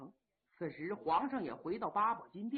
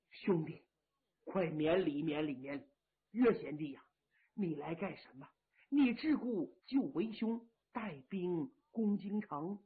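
lower, soft voice of a weary, kind marshal; steady old marshal; 5 semitones lower, 3 dB quieter than usual. Acted. Yang Liulang raising his kneeling generals in a lower, soft voice. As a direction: speak as the grave elder brother calming his men.

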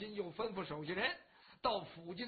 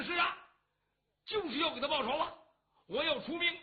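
agitated insistence; 7 semitones higher, 5 dB louder, faster than usual. Acted. Cheng Yaojin insists on attacking.

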